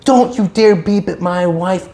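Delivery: mock screaming. Angry husband confronting a driver over beeping, mock screaming. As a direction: play an outraged man scolding, without full volume. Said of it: "he starts screaming".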